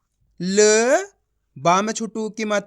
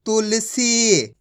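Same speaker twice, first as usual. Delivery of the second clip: Tulsi drawn out slowly; teacher blending syllables; slow blended word; much slower than usual. Shown as a demonstration. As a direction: stretch out Tulsi for the children. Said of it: "ठा में छोटू की मात्रा".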